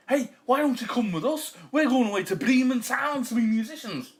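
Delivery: high, loud eager dog voice; talking dog. A narrator's reading. Dog invites cat to join musicians, high and loud. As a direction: urge a friend along as an excited dog. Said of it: "said the dog".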